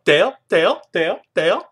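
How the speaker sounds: high, loud repeated squawks; Finding Nemo seagulls. Retold or imitated. Host imitates Claire calling Dale, squawking like a bird. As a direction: squawk each word like a gull, rising sharply. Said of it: "sounds like one of the birds in Finding Nemo".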